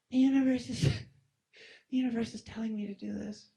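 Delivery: high, playful voice of the universe talking; high cosmic mock voice with a laugh. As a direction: squeak it out as the universe giving orders.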